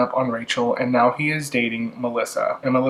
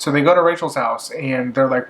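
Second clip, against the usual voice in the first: ordinary talk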